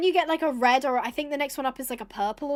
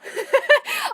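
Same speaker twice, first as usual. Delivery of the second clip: high burst of giggling; loud, real laugh, pitched way up; amused laugh; 6 dB louder than usual. Real, not acted. Streamer amused by donor's blunt refusal, amused laugh.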